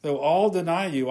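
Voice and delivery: ordinary talk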